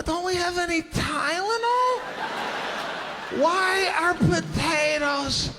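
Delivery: high, slow complaining shout; exasperated child asking; whiny kid protest. Retold or imitated. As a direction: plead like a feverish kid. Kid protesting potato remedy.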